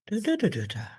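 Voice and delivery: "Da, da, da, da, da" sung out very high; hum-like high contour on "Da, da, da", dropping at the end; question tune demo. Shown as a demonstration. Teacher tracing a question's tune in syllables, high pitch. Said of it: "It's gonna go up".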